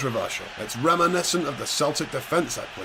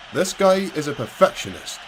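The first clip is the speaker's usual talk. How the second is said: ordinary delivery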